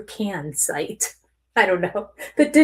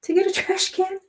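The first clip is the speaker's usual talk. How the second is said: incredulous question; 7 semitones higher than usual. Retold or imitated.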